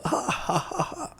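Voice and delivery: high, airy mocking laugh; imitated hysterical laugh. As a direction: mimic someone cackling at a challenger. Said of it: "like, hysterically".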